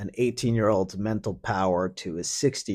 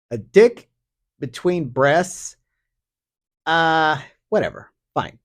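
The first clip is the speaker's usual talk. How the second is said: usual speaking voice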